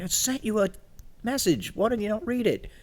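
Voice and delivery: high exasperated complaint. Retold or imitated. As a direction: scold him, exasperated. Scolding friend, retold.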